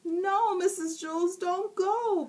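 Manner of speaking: put-on high, pleading boy voice; begging. A narrator's reading. Boy begging teacher to stay, high pleading. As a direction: beg her not to leave. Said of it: "begged Jason".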